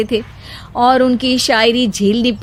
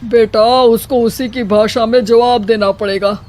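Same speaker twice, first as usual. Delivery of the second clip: a hint of an old father-in-law giving advice; father-in-law advising. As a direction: counsel her earnestly.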